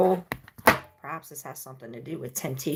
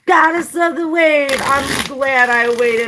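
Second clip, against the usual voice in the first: joyful outburst; 13 semitones higher, 11 dB louder than usual. Real, not acted. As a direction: belt it out with delight. Exuberant high performed outburst.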